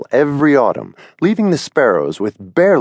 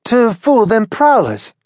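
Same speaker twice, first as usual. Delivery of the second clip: put-on mildly indignant high young bird; Billy, a little huffy, leaning on prowlers; Billy explaining his snoring, mildly indignant; 11 semitones higher than usual. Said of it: "said Billy indignantly".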